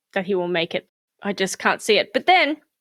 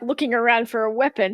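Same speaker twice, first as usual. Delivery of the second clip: ordinary delivery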